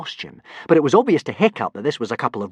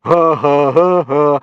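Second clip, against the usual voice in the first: loud, jeering spelled-out ha ha; mocking laughter; 4 semitones higher, 8 dB louder, slower than usual. Boys jeering at Hiccup with loud mocking laughs. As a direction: laugh at them like a pack of bullies. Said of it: "laughed all the other boys".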